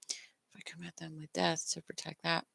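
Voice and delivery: unvoiced whisper while thinking it through. Real, not acted. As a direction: whisper it to yourself, eyes closed. Thinking aloud, eyes closed.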